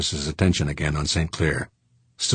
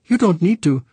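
put-on much higher, light French-accented voice; airy protest from a Frenchman waving it away; Frenchman Saint Clair; 12 semitones higher, 5 dB louder than usual.

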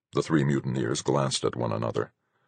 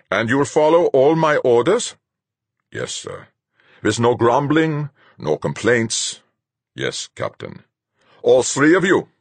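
commanding captain, cold; stern captain; 10 semitones higher, 6 dB louder than usual. A narrator's reading. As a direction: lay it out like a hard captain. Captain Fuchs' voice.

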